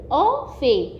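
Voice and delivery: اه في slowly, اه standing alone before في; separate syllables. Shown as a demonstration. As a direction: split it into اه, then في. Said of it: "قلت اه لوحديها عشان عليها جنكم".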